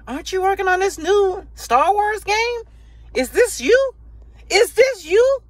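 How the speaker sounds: high, taunting sing-song question; mocking. YouTuber mocking EA with a high taunting voice. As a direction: jab at them with a high teasing voice. Mocking EA over Star Wars.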